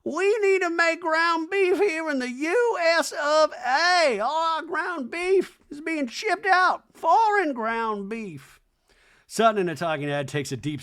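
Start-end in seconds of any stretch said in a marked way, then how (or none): 0.0-8.6 high, slow hammed-up Reba; lofty slow mock-mom rant. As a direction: drone it like a grand old dame. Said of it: "This is getting political".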